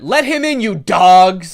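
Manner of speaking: building to a shout at the end; outraged demand. Acted. Outraged commenter to the school, mock-furious demand.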